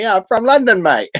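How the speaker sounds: lightly put-on London accent. Mimicking the co-host's accent, London accent. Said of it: "If I had to do an accent of you".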